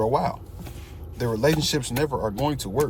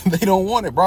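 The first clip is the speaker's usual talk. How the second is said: louder, emphatic restatement; louder repeat; 5 semitones higher, 7 dB louder than usual. Real, not acted. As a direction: hammer it home louder.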